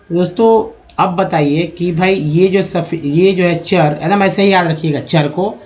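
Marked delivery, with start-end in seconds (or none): none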